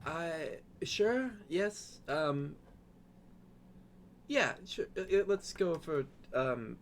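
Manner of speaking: high, halting character voice; flustered, high-pitched and faltering; Safia, hesitantly agreeing. Acted.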